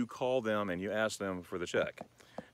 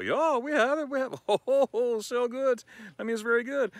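much higher, cheerful waiter voice; excited server; 13 semitones higher, 4 dB louder than usual. Retold or imitated. German server happily confirming, much higher. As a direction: play the thrilled waiter who has it.

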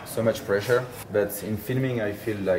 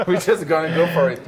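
high, louder laughing burst; 6 semitones higher, 4 dB louder than usual. Real, not acted. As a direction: laugh it out, no plan at all. Laughing about no strategy.

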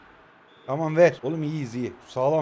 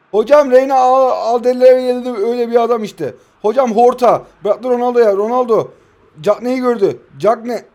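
loud, rising match-commentator call; excited football commentary build-up; play-by-play commentary; 4 semitones higher, 8 dB louder than usual. Pretend. Mock commentary.

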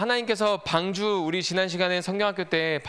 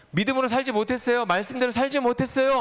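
high-pitched pleading confession; mock-earnest, high confession; acted repentant prayer; 7 semitones higher than usual. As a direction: plead as a sinner owning up to God. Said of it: "회개 기도하고".

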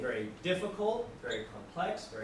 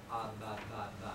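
father babbling; 7 semitones lower, 7 dB quieter than usual. Retold or imitated. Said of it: "The father goes".

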